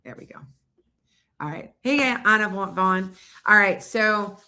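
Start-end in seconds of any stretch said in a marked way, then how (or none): none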